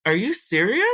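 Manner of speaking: half-crying, can't believe it; retelling a shocked reply on the phone; shocked disbelief. Retold or imitated. Shocked reply to his mother.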